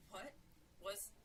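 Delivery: frightened hushed whisper; desperate whisper. A narrator's reading. Jill to Sarah after hearing the scream, desperate whisper. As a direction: whisper it, scared and urgent. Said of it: "Jill's desperate whisper".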